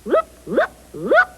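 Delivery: high whoop whoop sound effect; whooshing noise. Acted. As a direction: mimic a ball zooming past. Imitating the ball whizzing by.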